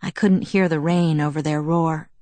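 usual speaking voice

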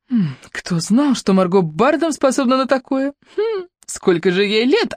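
breathless, amazed and drawn out; gasped amazement. Acted. Sabina gasps at Margo on the tightrope, amazed. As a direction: gasp it in wonder, slowly. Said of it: "ахнула Сабина".

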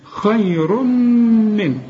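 خير من drawn very slowly with a clear unnasal n; slow clear izhar. Shown as a demonstration. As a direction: recite خير من without ghunna. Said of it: "ما تغنش هنا".

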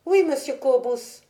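voix d'enfant plus aiguë; répondre en gamin docile; petit garçon obéissant. A narrator's reading. Le petit Ludwig répond à Cobus. (réponse de gamin, haut perchée)

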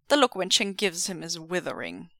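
usual speaking voice

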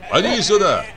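high, commanding call; shouted summons. Acted. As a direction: call a shepherd over from afar. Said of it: "крикнул Кузьмичёв чабану".

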